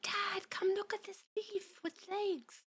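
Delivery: put-on high, soft, slow child voice; tiny kid calling out softly, drawn out; little cartoon kid. Imitating cartoon child calling Dad over, little kid voice.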